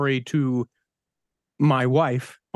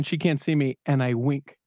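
normal talking voice

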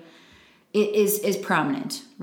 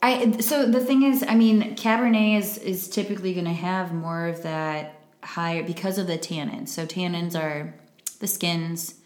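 ordinary talk

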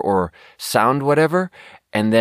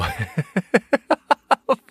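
high laugh; 12 semitones higher than usual. Real, not acted. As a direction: giggle up high. Laughs mid-story.

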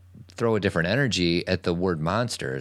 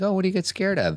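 much higher, lightly acted curious dad; dad's gentle question; 12 semitones higher, faster than usual. Father asking his scared son what he fears, high and gentle. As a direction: play the kindly father, lifting up.

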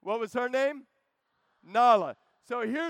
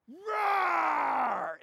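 higher, loud drawn-out roar; big roar; 7 semitones higher, 3 dB louder than usual. Retold or imitated. Mufasa scaring off the hyenas with a loud roar. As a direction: let out a mighty lion's roar.

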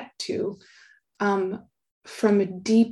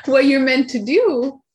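laughing through the words; 4 semitones higher, 8 dB louder than usual. Real, not acted. Laughing while making a point.

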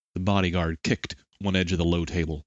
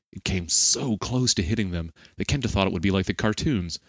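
ordinary delivery, nothing marked

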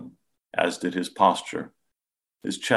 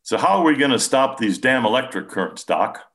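higher, louder blunt working man; a blunt, impatient father voiced higher and louder; impatient Joe; 6 semitones higher, 6 dB louder than usual. A narrator's reading. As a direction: push for a fix, irritated.

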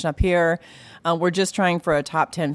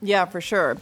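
normal talking voice

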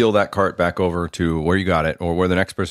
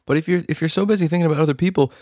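high mock voice; 9 semitones higher than usual. Mock selfish counterargument, high voice.

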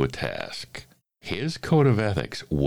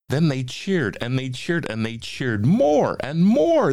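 rising higher and louder with each repeat; cheering narration; 11 semitones higher, 6 dB louder than usual. A narrator's reading. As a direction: build it up like a growing cheer.

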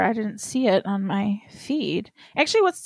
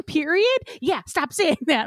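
retold scolding; 8 semitones higher than usual. Acting out family argument over period.

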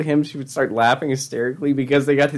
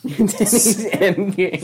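slow, laughing, much higher; 7 semitones higher, much slower than usual. Real, not acted. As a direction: laugh the title out. Echo while laughing.

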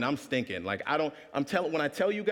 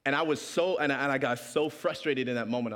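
usual speaking voice

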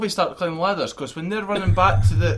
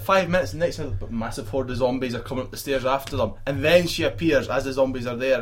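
usual speaking voice

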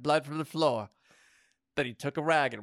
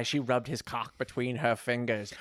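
ordinary delivery

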